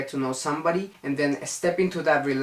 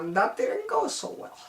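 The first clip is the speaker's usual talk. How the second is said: mock high voice; 3 dB quieter than usual. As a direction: jump into a very high, softish comic voice. Joking about a failed third attempt.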